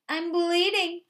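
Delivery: a loud, high yelp of alarm; the fox yelps loud and high when he wakes up. A narrator's reading. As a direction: cry out in panic on waking. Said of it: "the fox yelped".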